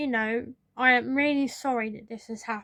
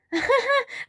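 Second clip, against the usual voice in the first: high amused giggle before speaking; laugh; 13 semitones higher, 4 dB louder than usual. Retold or imitated. Boss laughing off the mix-up with a high giggle. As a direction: giggle like the whole thing is a joke.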